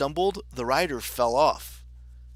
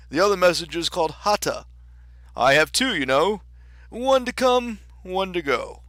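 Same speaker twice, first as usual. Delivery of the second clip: louder, lightly voiced storybook king; 6 semitones higher, 6 dB louder than usual. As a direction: explain it with kingly fuss.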